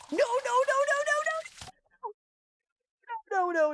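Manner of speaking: very high, rapid panicked no's; real panicked plea at the screen; panicked pleading. Real, not acted. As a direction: squeal for it to stop. Begging it to stop.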